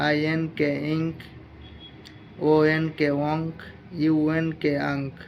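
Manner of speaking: deliberate, drawn-out classroom drilling; slow phonics drill. Shown as a demonstration. Teacher reading sounds slowly for students to follow. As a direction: spell each letter, then say the word slowly. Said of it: "এটা ধীরে ধীরে পড়াচ্ছি।".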